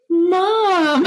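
put-on very high, drawn-out child's "Mom"; son's call. Mother imitating her son calling her, high and sweet. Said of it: "he'd be like".

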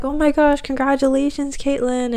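high-pitched, gushing retold cheer; delighted congratulations. Client congratulating her on getting in, delighted. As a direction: imitate a thrilled well-wisher, voice lifted high.

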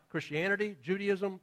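normal talking voice

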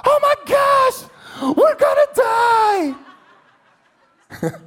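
very high, very slow, hammed-up panicked shout; picture God losing it, played for laughs; hysterical God. Preacher imagining God waking up hysterical, hammed-up panic. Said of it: "he's like".

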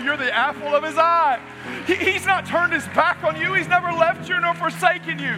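high, loud preaching at full force; impassioned preaching. Acted. Preacher assuring the congregation, loud impassioned preaching. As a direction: drive the promise home to the congregation.

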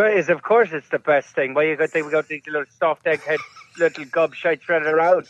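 thick stage-Irish brogue, words slurred together; mumbled comic Irish priest; garbled Irish accent. Acted. Father Flanagan answering the spanking question, garbled Irish accent. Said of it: "I couldn't understand a word he said".

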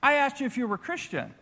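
pointed question. Retold or imitated. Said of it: "He said".

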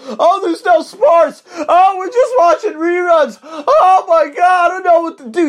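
high, loud whiny mockery; mocking whiny Americans. Mocking Americans stuck watching reruns, high, loud and whining. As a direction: wail like a helpless couch potato. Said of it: "like a lot of these fucking Americans".

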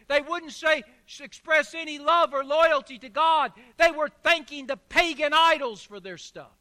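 fervent pulpit delivery; preaching. Acted. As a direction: preach it out to the congregation. Preacher at full force.

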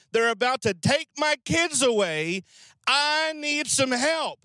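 hammed-up, high exasperated widow; mock exasperation. Widow answering the prophet who offered help, exasperated.

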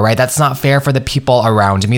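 ordinary talk

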